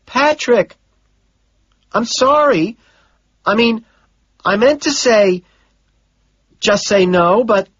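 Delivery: a secretary passing on messages; Gene's voice. Acted. Secretary apologising on phone.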